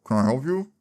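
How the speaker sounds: imitating words muffled by food; mouth full. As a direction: ask it through a mouthful of sandwich. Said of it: "with a mouthful of chicken sandwich".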